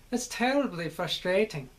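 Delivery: light, musical British syllables; lilting British with a slight Scottish tinge; British accent. Shown as a demonstration. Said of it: "that's where you get the British".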